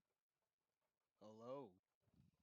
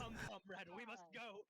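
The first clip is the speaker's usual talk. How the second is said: heroic rallying cry; 8 semitones higher, 4 dB louder than usual. Acted. Character rides off heroically.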